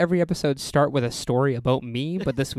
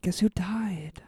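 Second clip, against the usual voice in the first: retold stunned question; 6 semitones higher, much slower than usual. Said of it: "And I went".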